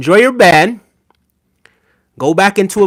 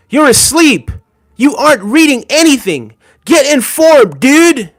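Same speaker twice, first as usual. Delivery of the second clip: higher, louder, mocking the angry chat comment; mock angry read; 8 semitones higher, 4 dB louder than usual. Reading a viewer's chat comment aloud, in mock anger. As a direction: shout it back like an outraged commenter. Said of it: "You sound very angry".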